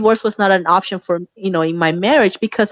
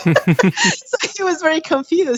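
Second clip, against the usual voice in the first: laughing; 10 semitones higher, slower than usual. Real, not acted. Laughing while retelling confusion.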